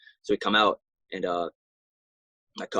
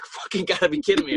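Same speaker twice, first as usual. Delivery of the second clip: high, laughing exasperation; laughing disbelief; 9 semitones higher than usual. Retold or imitated. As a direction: laugh it out, can't believe it. Bell saves opponent again.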